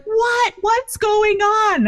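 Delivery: very high, slow bewildered what; a lost husband grasping for news; panicked confusion. Retold or imitated. Husband panicked, can't hear call.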